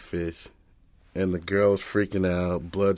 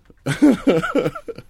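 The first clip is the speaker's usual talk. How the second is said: high, loud burst of laughter; hearty laugh; 10 dB louder than usual. Real, not acted. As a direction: laugh out loud at your own story. Laughs at own story preview.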